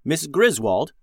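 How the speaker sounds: usual speaking voice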